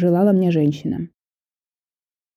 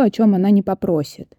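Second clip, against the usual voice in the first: usual speaking voice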